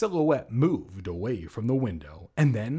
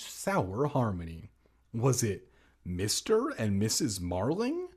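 ordinary delivery

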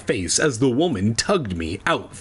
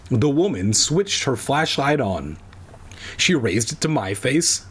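ordinary delivery

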